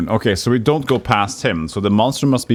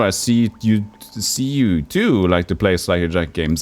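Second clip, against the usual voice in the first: usual speaking voice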